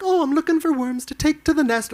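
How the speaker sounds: high, sing-song little bird voice; sweet storybook critter talk; Mary Poppins animal voice. Retold or imitated. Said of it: "She goes full Mary Poppins".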